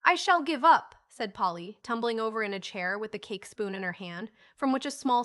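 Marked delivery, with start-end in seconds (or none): 0.0-1.0 high, loud exclamation of defeat; loud, lifted cry of giving up; exasperated. A narrator's reading. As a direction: throw it out in exasperation. Polly gives up, exasperated.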